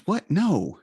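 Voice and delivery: retold incredulous no; surprised denial. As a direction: act out a kid's baffled denial. Son's startled denial, retold.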